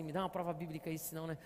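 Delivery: a low, quiet doubting voice asking for "prova bíblica"; skeptical inner voice. Pretend. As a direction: mutter it like a cynical voice in your head. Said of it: "sempre vem cá uma voz assim". (voz interior cética, baixa e desconfiada)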